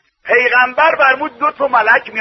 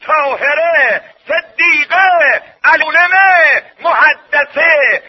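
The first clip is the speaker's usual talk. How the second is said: raised, drawn-out pulpit delivery; full-force preaching; 6 semitones higher, 3 dB louder, slower than usual. Acted. Preacher lists children's rights and Fatima's titles, full-force preaching. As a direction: ring out each name slowly like a roll call.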